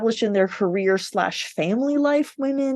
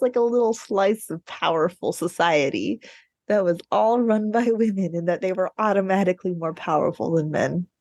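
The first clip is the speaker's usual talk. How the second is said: usual speaking voice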